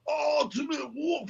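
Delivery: high, drawn-out mock yell, volume held down; a slow, strained-back war cry; retold battle yell. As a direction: holler it high without full volume. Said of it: "finished yelling the word".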